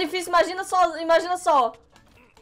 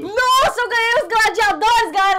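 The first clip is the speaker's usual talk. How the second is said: excited celebration; 8 semitones higher, 7 dB louder than usual. Real, not acted. Comemorando a vitória.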